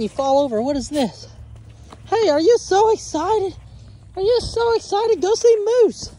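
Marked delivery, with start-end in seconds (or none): 2.1-6.1 excited puppy talk. Real, not acted.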